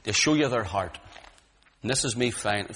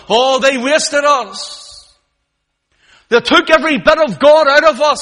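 howling lament; 13 semitones higher, 15 dB louder than usual. Acted. Captives crying against those who wasted them, howling. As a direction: howl it out like captives in pain. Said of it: "they were howling".

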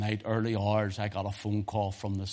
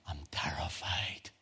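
slow, breathy whispered fear; terrified whisper; 4 semitones lower, 5 dB quieter, slower than usual. Retold or imitated. Dying man tells his sister he is terrified, whispered. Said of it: "He says".

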